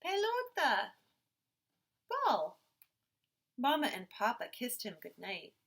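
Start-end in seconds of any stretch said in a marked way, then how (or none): 0.0-2.6 put-on high, loud little-boy naming words; eager toddler words. A narrator's reading. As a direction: say it like a tiny child showing his toys. Toddler naming his toys.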